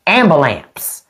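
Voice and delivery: AMBULANCE turned into "am-buh-lamps"; Southern "am-buh-lamps"; deliberately wrong ambulance. Shown as a demonstration. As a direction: end "Ambulance" on a country "lamps". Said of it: "it's hard to say it wrong".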